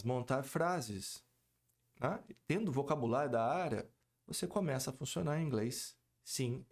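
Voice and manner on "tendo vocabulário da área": ordinary delivery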